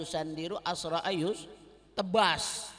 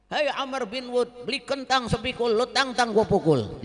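pantun taunt; 4 semitones higher, 5 dB louder than usual. Acted. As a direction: recite the rhyme as a dare. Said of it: "ada pantun-pantunnya juga".

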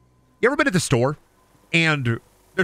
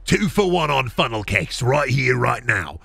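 shady salesman pitch; 3 semitones lower, slower than usual. Acted. Strange man pitching funnel cakes.

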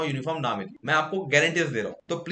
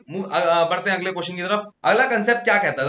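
normal talking voice

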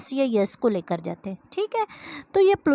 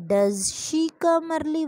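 slow dictation; 3 semitones higher, much slower than usual. Shown as a demonstration. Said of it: "वही जो does होता है वो start में राइट कर देते हैं".

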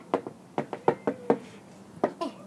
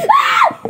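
sudden piercing startled scream; real scream; 16 dB louder than usual. Real, not acted. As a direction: scream at the jump scare. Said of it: "That was scary".

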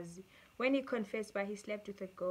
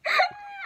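short, high, loud burst of laughter; squealed laugh; 8 dB louder than usual. Real, not acted. Vlogger cracking up over the men in confessions, squealing with laughter. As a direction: let out a sudden squeal of laughter.

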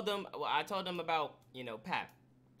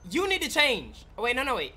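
alarmed outburst; 4 semitones higher, 7 dB louder than usual. Acted. Panics about the green shirt.

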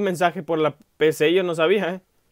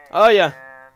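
sudden loud exclamation; startled; 8 dB louder than usual. Real, not acted. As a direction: blurt it out in surprise.

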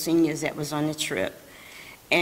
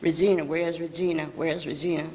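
ordinary talk, nothing marked

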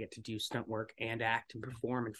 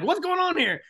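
much higher, louder demand; alarmed demand; 10 dB louder than usual. Retold or imitated. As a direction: cop reaching for his gun.

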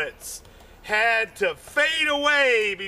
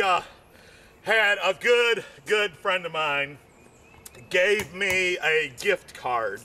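normal talking voice

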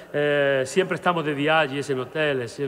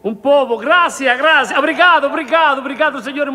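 loud thanks; 8 semitones higher, 9 dB louder than usual. Real, not acted. Thanking audience for correction.

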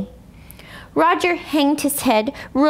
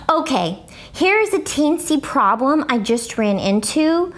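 ordinary delivery, nothing marked

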